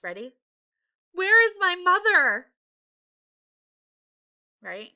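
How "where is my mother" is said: high, loud frightened cry; scared. Shown as a demonstration. Teacher modelling the bear missing its mother, scared. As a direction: wail it like a lost little bear. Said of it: "You should sound something like this".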